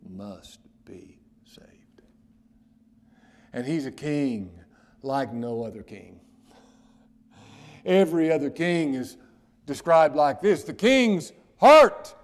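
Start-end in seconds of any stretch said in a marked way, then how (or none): 0.1-2.0 slow, low reverent whisper. Real, not acted. Preacher assuring the listener in a slow whisper. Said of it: "whispered sentence end". 7.8-12.1 loud, high, slowing on heart; preaching at full force. Acted. Preacher proclaiming scripture, loud and high.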